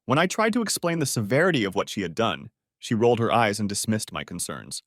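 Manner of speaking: usual speaking voice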